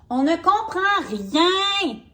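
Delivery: high, slow puzzled Drago voice. Acted. Drago tells his friends he can't understand, high and slow. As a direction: stretch out Drago's confusion. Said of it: "a dit Drago".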